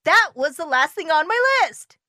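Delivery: high, loud exclamation; excited shout. A narrator's reading. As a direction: cry out a sudden realisation. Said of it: "shouted Toad".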